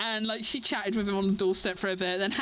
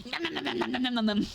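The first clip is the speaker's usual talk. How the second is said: rapid-fire cartoon nom-noms; playful munching noises; faster than usual. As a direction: gobble it up in pretend, giggling. Pretends to gobble the drawn lines.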